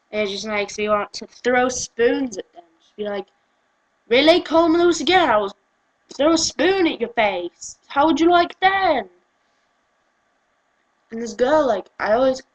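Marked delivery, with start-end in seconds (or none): 4.1-9.1 higher, louder indignant challenge; mock angry threat. Ranter threatens people who mispronounce the name, mock anger. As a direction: challenge them in comic outrage.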